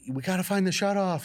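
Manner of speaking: high, loud urgent call; retold urgent shout; urgent yell. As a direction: push it out in alarm. Urgent during gas leak.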